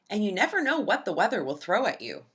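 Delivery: plain reading voice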